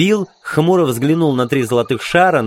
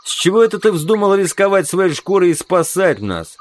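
higher-pitched, mistrustful query; suspicious question; 7 semitones higher than usual. Acted. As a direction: question him with open suspicion. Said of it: "подозрительно спросил он". (подозрительный вопрос)

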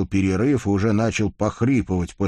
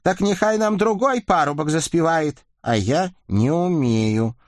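high rusalka voice; 10 semitones higher than usual. A narrator's reading. Rusalka and Vakula exchange.

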